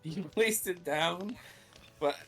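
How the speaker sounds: lightly voiced ASMR streamer. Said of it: "It sounded like I was, like, an A S M R streamer".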